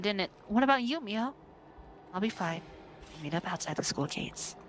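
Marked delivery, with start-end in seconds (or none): 2.1-4.6 put-on low, soft steady girl's voice; calm reassurance. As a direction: reassure a friend before danger. Voices Mio staying behind.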